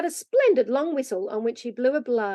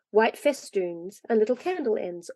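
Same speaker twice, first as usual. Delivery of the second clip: plain reading voice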